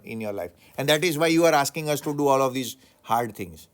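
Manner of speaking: loud, scornful rant at the teacher; angry outburst. Acted. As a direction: tear into him with loud contempt. Imagined student scolding teacher.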